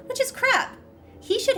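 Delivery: sharp high scoff; indignant. Real, not acted. Reacting to the spirit's grudge, indignant scoff. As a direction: scoff at the unfairness. Retort to game text.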